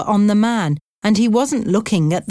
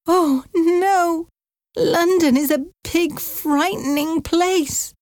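high, quavering worried aunt voice; an elderly aunt scared of losing her home; frightened old aunt; 11 semitones higher, slower than usual. Acted. Aunt Sarah fearing she must leave, high and frightened.

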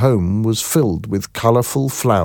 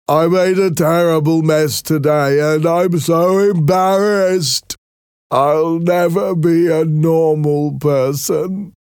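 high, slow, gentle-mannered Merrick voice; timid deformed man, high and slow; Merrick's voice; 8 semitones higher, slower than usual. A narrator's reading. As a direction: voice the shy Merrick higher and slower than the narration.

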